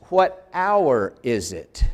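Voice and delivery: "What hour is it?" said very slowly, each word set apart; slow literal gloss. Shown as a demonstration. Teacher slowing down to show a student the literal meaning. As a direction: spell out the literal wording word by word. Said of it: "Literally, that means".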